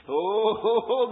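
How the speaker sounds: usual speaking voice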